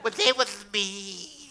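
high, slow put-on child voice; mock pitiful grandchild; tattling child. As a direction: whine it like a little tattletale. Plays grandchild tattling on parents.

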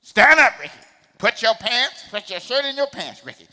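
imitated mama ordering her son about; scolding mama. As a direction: play a mama nagging her boy. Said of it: "That's what my mama said".

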